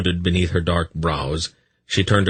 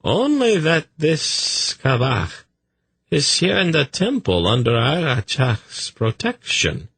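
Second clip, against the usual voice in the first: high, swooping, ingratiating voice; deferential messenger; 10 semitones higher than usual. A narrator's reading. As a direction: play a polite visitor addressing a priestess.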